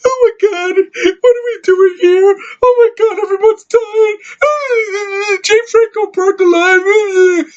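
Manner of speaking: very high, whiny fake sobbing voice; mock crying woman. Reviewer mocking the film's crying heroine, very high whiny mock sobs. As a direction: ham up a weepy panicking heroine, blubbering. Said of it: "She's like, crying little bitch".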